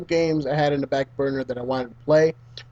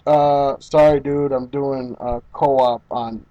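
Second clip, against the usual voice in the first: slow, lower apologetic reply; friend's brush-off; 4 semitones lower, slower than usual. Retold or imitated. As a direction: imitate a friend begging off, dragged out and low. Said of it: "I get a message back".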